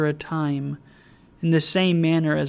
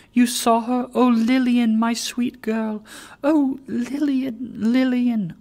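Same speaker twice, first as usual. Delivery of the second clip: anguished cry; 8 semitones higher, 5 dB louder than usual. Acted. Meg crying out for Lilian.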